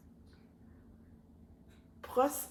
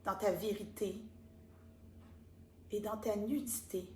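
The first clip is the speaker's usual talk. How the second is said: méditation guidée; 7 dB quieter than usual. Acted. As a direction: lead them softly into calm. Guides a card-reading meditation. (voix de méditation guidée, lente et douce)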